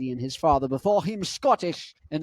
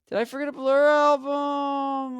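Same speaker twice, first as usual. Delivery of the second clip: mock panic; 10 semitones higher than usual. Vlogger finding an album missing, mock-panicked wail. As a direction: repeat it as a long, high cry of disbelief.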